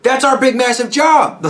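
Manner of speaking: mock-frantic high-pitched pleading; panicked union. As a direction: stammer it out in high alarm. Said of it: "And they went".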